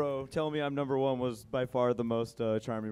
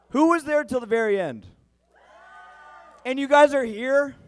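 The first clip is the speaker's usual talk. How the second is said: surprised loud call; 10 semitones higher, 12 dB louder than usual. Real, not acted. Host calling out to crowd.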